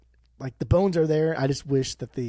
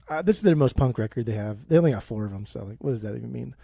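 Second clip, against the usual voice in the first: ordinary talk, nothing marked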